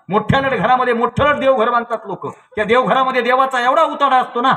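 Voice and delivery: high, loud exclaiming; emphatic criticism. Real, not acted. Preacher decrying huge shrines crowded with gods, high and emphatic. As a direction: hammer the exaggeration home.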